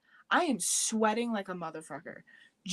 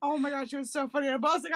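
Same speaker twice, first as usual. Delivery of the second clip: usual speaking voice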